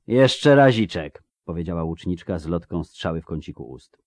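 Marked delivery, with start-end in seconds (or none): 0.1-1.2 slow, raised, stretched-out words; unhurried insistence. A narrator's reading. Archer wanting one more shot, slow and insistent. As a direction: draw it out, refusing to hurry.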